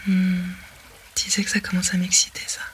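ordinary talk, nothing marked

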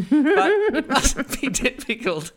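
laughing through the words; slow, giggling delivery. Real, not acted.

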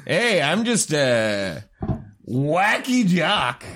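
ordinary talk

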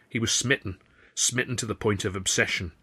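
usual speaking voice

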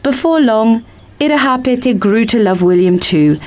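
ordinary delivery

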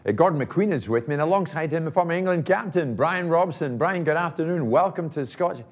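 high, bright studio anchor welcome; TV presenter voice. Retold or imitated. [name] mimicking a studio presenter welcoming Brian Robson. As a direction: play the chirpy sports host introducing guests. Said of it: "And they're like".

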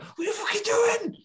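high-pitched mock outrage; livid. Lestat scolds over strangled dogs, livid. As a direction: squawk it out, comically livid. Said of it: "he's livid".

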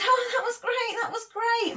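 high-pitched, over-keen praise; feigned enthusiasm. Retold or imitated. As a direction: gush in a high voice as if you loved it. Feigns enthusiasm while feeling embarrassed.

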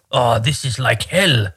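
lightly voiced coworker, slow and heavy; a hint of a grumbling Norwegian coworker; mimicking a coworker's literal Norwegian-English complaint; imitating coworker. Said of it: "he'd say it in English".

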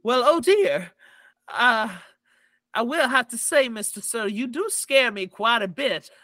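high, prim halfling lady; fussy and politely nervous; a dainty little reporter, a bit rattled. Acted. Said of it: "she goes".

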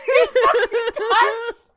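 high, loud, drawn-out cry through laughter; laughing shout. Real, not acted. Co-host agreeing he really says peach emoji, laughing shout. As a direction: shriek it slowly while cracking up.

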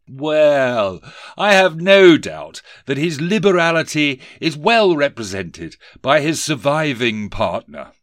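a lightly voiced toff asking politely; pompous gentleman. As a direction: collector addressing the office owner. Rees-Mogg flatters.

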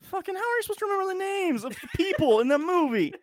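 high, rising to loud indignant rant; exasperated outburst. Real, not acted. Player complains the quiz is rigged, exasperated yell. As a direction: protest loudly like a playful sore loser. Mock outrage at losing a question.

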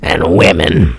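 very low hammed-up villain voice; mock killer. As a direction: finish deep as a pretend killer. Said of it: "The killer".

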